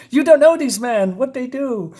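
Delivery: high-pitched, urgent warning; pleading woman. Acted. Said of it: "And she says".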